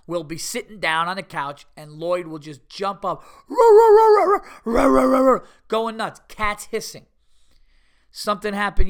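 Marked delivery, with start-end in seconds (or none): 3.5-5.5 loud high yapping dog barks; barking like a dog. Retold or imitated. Man imitating his dog going nuts at the cats, loud barks. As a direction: bark it out, shrill and frantic like the dog.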